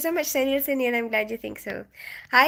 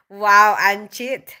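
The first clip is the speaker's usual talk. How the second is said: usual speaking voice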